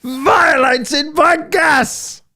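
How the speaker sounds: very high, very slow, drawn-out mocking shout. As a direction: stretch his words into a ridiculous yell. Mocking echo of debater's line.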